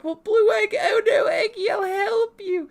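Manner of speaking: very high, loud, wailing cry; hammed-up rescuer's shriek to someone in danger; mock distress. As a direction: wail it in squealing mock panic. Acting out rescuer crying to egg.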